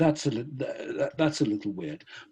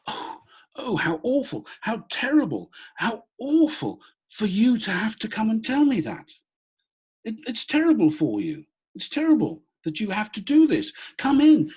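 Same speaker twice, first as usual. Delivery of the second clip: high, bright imitation of a kind woman; imitating the widow's sweet, higher voice; woman's voice, imitated; 10 semitones higher than usual. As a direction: voice her high and sympathetic, hand pressed to chest. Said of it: "she said to me".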